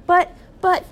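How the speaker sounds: high, loud stammer; startled wife's stuttering outburst; shocked cry. A narrator's reading. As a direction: sputter it out in shock. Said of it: "cried Missus Gregg".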